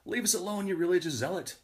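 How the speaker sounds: high-pitched yell held back in volume; half-voiced, high shout of annoyance; mock yell. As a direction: call it out as if from a passing car. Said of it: "the guy in the car yells".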